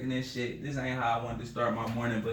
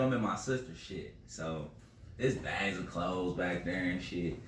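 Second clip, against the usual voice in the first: ordinary talk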